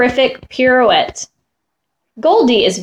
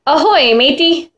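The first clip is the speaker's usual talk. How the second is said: pirate; 5 semitones higher than usual. Acted. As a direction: call out like a playful pirate. Child switches to pirate game.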